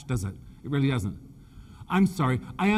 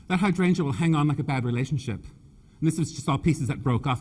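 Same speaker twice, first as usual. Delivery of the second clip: ordinary delivery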